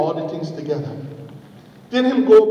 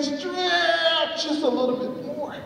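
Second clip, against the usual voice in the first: drawn-out, high, strained narration; higher and slower, as if stretching; straining stretch; 9 semitones higher, 5 dB quieter, much slower than usual. A narrator's reading. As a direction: pull the words out with effort.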